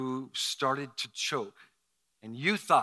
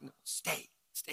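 hushed retold command; 10 dB quieter than usual. Church people hold the new pastor back, imitated hush. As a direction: quietly tell someone to stay put. Acting out bystanders holding him back.

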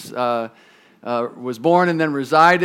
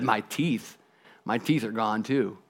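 put-on low, soft old man's reply; lightly voiced weary old boy; aged boy; 7 semitones lower, 10 dB quieter than usual. Aged boy answers the tree.

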